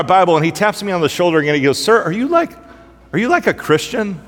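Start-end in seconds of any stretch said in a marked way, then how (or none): 1.8-4.2 higher, halting question with pauses; imitating incredulous question. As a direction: play a puzzled passenger tentatively asking. Passenger hesitantly asking about faith.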